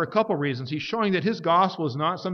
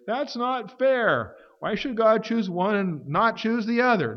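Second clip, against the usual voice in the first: whiny complaint; 7 semitones higher, slower than usual. Retold or imitated. Voicing a listener's complaint.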